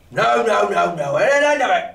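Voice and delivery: loud, high furious denial; captain roars his refusal; angry cry. A narrator's reading. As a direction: shout it down as the captain. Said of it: "he cried again".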